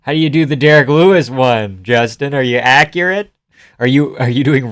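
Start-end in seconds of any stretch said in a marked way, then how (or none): none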